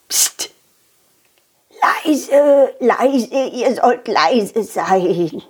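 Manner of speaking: put-on slow shushing old fairy. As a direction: hush them slowly.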